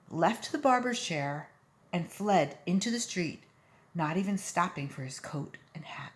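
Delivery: ordinary delivery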